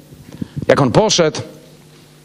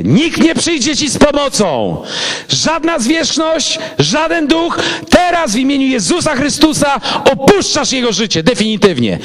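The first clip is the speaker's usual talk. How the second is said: high, slow, forceful command to the spirit; commanding exorcism; 8 semitones higher than usual. Retold or imitated. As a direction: cast the demon out with authority. Commanding the demon out. (pastor commanding the demon to leave, forceful and slow)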